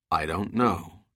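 low, soft and grave; solemn. A narrator's reading. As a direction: say it heavy with worry. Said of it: "Dirk said solemnly".

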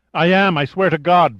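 high, loud desperate plea; imploring. A narrator's reading. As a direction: beg to be believed. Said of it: "Varenne implored".

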